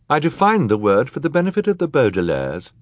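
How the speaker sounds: high, emphatic judge's retort; judge, firmly explaining. A narrator's reading. Judge answering a heckler in court, firm.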